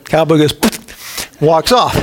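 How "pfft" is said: dismissive pfft. Retold or imitated. Cowboy dismissing it.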